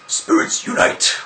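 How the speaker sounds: loud, voiceless rasped call; mock battle cry. Imagines throwing ninja stars, mock rasped battle cry. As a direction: draw it out like a whispered war cry.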